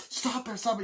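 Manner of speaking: high-pitched urgent begging; desperate mock plea; acted frantic plea. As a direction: imitate a panicked bystander. Acting pleas during standoff.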